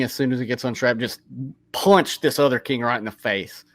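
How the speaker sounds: ordinary talk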